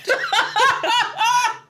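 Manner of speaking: loud, high burst of laughter; laughing. Real, not acted. Reader breaking up over the baby monkey story, loud laughing. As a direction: crack up helplessly. Cracks up while reading.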